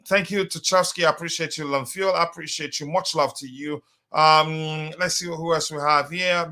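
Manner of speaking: usual speaking voice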